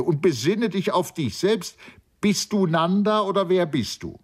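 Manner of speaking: high, reproachful demand; impatient rebuke. A narrator's reading. Sri Daman rebukes Nanda, exasperated and high. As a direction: challenge him with irritation. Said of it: "die kräftigen Glieder unwillig regte".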